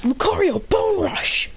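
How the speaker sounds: high-pitched attack call; battle command. Acted. Trainer ordering Lucario to attack, high battle cry.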